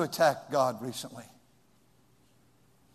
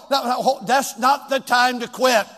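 ordinary delivery

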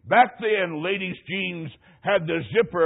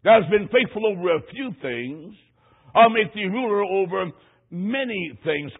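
solemn scripture; 2 semitones higher, 4 dB louder than usual. Acted. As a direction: intone the words of the Master. Repeats scripture slowly.

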